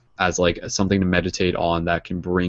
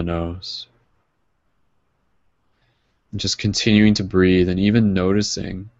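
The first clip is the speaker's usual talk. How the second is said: guided meditation. Acted. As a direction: guide them gently into calm. Leads a closing meditation.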